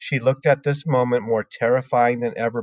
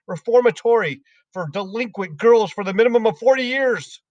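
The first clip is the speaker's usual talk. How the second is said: shouting; 10 semitones higher than usual. Acted. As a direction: spit each insult out. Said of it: "the Trunchbull was shouting".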